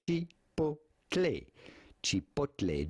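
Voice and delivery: Chipotle said slowly as chi-POT-leh; slow pronunciation guide. Shown as a demonstration. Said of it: "Do not say Chipotle, but rather".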